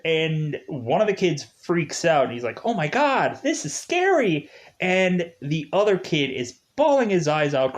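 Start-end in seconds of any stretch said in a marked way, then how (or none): 2.6-4.5 imitating a scared kid, much higher; mock scared kid. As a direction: squeal it like a frightened child. Kid freaks out at horror film.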